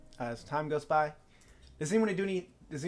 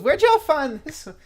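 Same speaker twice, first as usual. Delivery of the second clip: chuckling drawl; 15 dB louder than usual. Acted. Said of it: "chuckles and goes".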